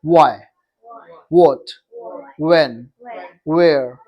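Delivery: WHY, WHAT, WHEN, WHERE each begun on rounded lips; rounded w words. Shown as a demonstration. Teacher drilling question words with class, rounded w. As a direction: separate each word, w rounded like "oo". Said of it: "mouth को जब round करते हो".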